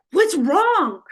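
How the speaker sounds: alarmed. Retold or imitated. Friend at door in tears.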